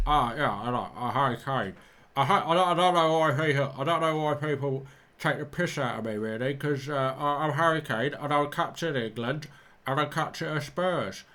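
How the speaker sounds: lightly voiced Harry Kane, close to his own; flat footballer monotone; Harry Kane impression. Retrying the Harry Kane voice for the viewers. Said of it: "I used to be able to do Harry Kane".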